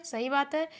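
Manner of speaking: usual speaking voice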